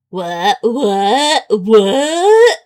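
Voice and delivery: disbelief. Real, not acted.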